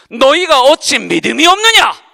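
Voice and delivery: loud, stern question with heavy fall on 없느냐; stern rebuke. Retold or imitated. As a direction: scold them for their lack of faith. Quoting Jesus rebuking disciples. (없느냐를 힘주어 꾸짖는 목소리)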